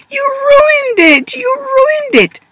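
very high, loud tearful wail; crying wail. Acted. Zoe wailing at her brother who wrecked her pizza, tearful and very high. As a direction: wail like a little girl in tears. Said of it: "Zoe cried".

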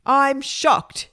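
a touch of theatrical shock. Acted. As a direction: exclaim in shock. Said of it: "Margaret was shocked".

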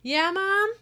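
high loud call back to mom; kid calling back. Acted. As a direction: shout back from a bedroom. Kid answering mom's call.

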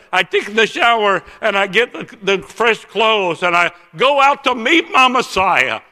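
imitated tearful excitement, high and loud; crying. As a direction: cry out his overwhelmed hope, pitch climbing. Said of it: "And he's crying now".

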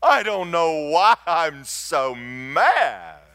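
high mock wail, drawn out slowly; retold weeping. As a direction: act out a tearful cry to God. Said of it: "And I'm weeping".

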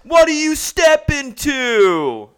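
high, slow, loud confrontational challenge; Robin impression. Quoting Robin from The Rehearsal, drawn-out high challenge. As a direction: step up and draw it out like a threat. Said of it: "when Robin goes".

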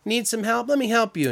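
put-on high, sugary offer to an old aunt; overly polite fake kindness; syrupy helper voice; mock sweet helper. Fake helpful voice.

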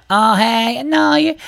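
squeaky high mock interruption; heckling drunk woman. Drunk woman interrupting the first comic, squeaky high. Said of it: "she's talking".

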